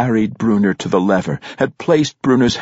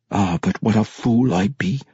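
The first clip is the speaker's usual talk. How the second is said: self-reproachful dwarf grumbling to himself; understated mutter of self-disgust; muttering through clenched teeth. A narrator's reading. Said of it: "he muttered through clenched teeth".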